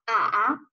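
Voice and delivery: slow 啊 dipping down, then rising; drawn-out dipping 啊; third tone. Shown as a demonstration. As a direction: bend 啊 downhill and back up. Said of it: "我们再来一起说一遍".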